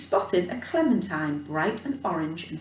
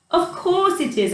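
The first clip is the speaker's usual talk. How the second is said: high, louder glad exclamation; old man suddenly remembering, high glad exclamation; exclaiming; 9 semitones higher, 4 dB louder than usual. Acted. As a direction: burst out with sudden joy. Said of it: "exclaimed the old man".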